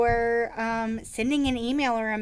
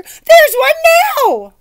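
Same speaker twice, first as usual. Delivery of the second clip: very high, loud pointing cry; fake alarm; 15 dB louder, slower than usual. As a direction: cry it out to distract him.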